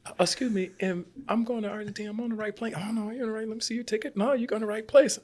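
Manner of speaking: high, worried voice asking the flight attendant; confused traveler. Retold or imitated. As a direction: play both sides in a high anxious voice. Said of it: "I'm like".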